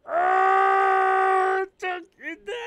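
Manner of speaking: high, drawn-out screams of disbelief; shocked screaming. Real, not acted. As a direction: scream as if scandalised, stretching the words. Shocked reaction to the lyrics. (gulat na gulat, pasigaw at mataas)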